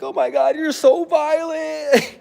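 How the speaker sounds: high, slow dramatic whine; mock girly voice. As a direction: stretch it out high and swooning. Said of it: "then she was like".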